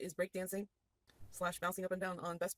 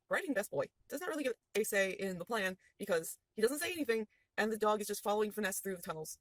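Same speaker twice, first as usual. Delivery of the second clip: usual speaking voice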